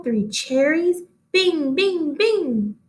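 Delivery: ordinary delivery, nothing marked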